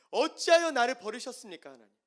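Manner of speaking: high, loud anguished plea to God; anguished cry. Acted. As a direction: cry out as one forsaken. Psalmist's cry re-voiced. (높고 크게 절규하는 외침)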